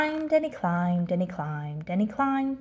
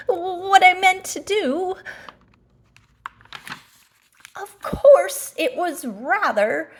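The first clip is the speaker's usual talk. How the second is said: high, loud flustered yelp; loud, high breathless complaint; falling cry; 10 semitones higher, 7 dB louder, slower than usual. Acted. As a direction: call out mid-tumble. Pooh still falling.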